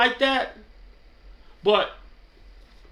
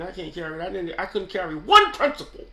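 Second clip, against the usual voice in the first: normal talking voice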